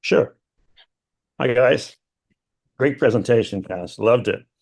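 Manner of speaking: tired, raspy voice after travel. Real, not acted. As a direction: speak with a rough, raspy throat. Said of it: "sorry, I got a raspy voice".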